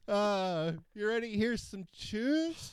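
high, shaky nervous greeting; squeaky nervous stage greeting. Retold or imitated.

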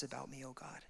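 breathy whispered prayer; whispered awe. Real, not acted. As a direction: breathe the name as a hushed prayer. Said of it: "whispered end of the psalm reading".

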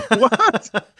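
high, laughing what; incredulous laugh; laughing disbelief. Retold or imitated. Retells own disbelief at the job.

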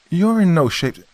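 high, loud protest, cut off. Acted. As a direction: object urgently.

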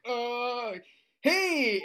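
high, loud startled hello; acted overbright hey after a hesitation; startled greeting. Caught by a parent in cannabis shirt, high startled greeting.

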